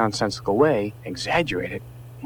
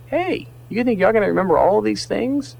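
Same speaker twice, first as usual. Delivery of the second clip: higher-pitched inner doubter; little doubting voice; 9 semitones higher than usual. Acted. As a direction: lightly voice a nagging inner doubt. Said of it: "may have a little voice that goes".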